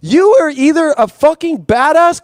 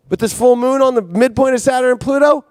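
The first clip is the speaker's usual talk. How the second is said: usual speaking voice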